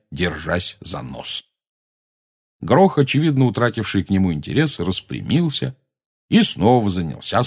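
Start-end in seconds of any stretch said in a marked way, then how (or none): none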